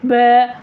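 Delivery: बै drawn out with the mouth barely open; closed-mouth wrong vowel. Shown as a demonstration. Teacher demonstrates a wrong reading, narrowed बै. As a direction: squeeze the vowel of बै towards ae. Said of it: "ये गलत है".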